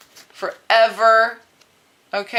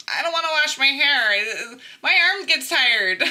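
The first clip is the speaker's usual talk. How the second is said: hammed-up higher-pitched complaint; mock whining; 5 semitones higher than usual. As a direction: imitate someone whining about a chore. Acts movie character refusing chores.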